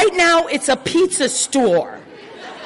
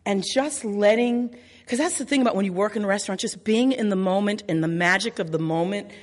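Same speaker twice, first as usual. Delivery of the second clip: softer, lower heartfelt close; sincere reflection; 7 semitones lower, 5 dB quieter than usual. Real, not acted. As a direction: speak from the heart about your father.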